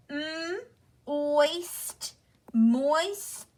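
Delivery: "m, oist, moist" built up from separate sounds; sounding out. Shown as a demonstration. Teacher showing pupils the spelling sounds, "m, oist, moist" sounded out in parts. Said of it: "So it's actually pronounced".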